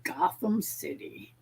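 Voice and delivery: breathy stage whisper of a city's name; mock dramatic whisper. Friend whispering a Batman reference to the group, mock dramatic. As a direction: hush it like a comic-book secret.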